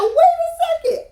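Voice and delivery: high, slow, drawn-out wait a second; stunned disbelief. Retold or imitated. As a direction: pitch way up in disbelief. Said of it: "I said".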